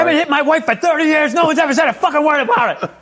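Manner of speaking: high-pitched parody rant with laughter breaking in; mock a furious man defending the indefensible; mock outraged yelling. Comic mocking the wrong-way driver's argument, yelling indignantly.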